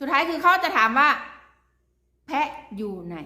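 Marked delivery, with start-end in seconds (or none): 2.3-3.2 low, much softer mock officer question; quiet low quote. Vlogger imitating the officer to the ex, low and much softer. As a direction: drop the voice right down for the question. Officer will ask where goat is.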